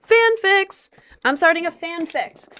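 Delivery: high, loud excited announcement; excited reveal. Real, not acted. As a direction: unveil it with a flourish.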